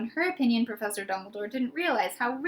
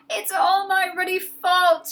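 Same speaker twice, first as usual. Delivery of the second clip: slow, loud, higher sobbing voice of a giant; 8 semitones higher, 7 dB louder, slower than usual. A narrator's reading. Hagrid blaming himself to Harry, sobbing loudly. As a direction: wail it through tears. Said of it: "he sobbed".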